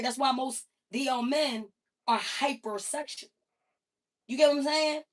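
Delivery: ordinary talk, nothing marked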